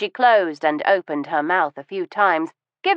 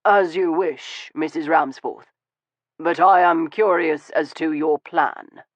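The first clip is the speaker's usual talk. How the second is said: conversational tone. Acted. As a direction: keep it casual despite the gun. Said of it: "he said in a conversational tone".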